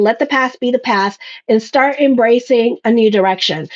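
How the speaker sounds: normal talking voice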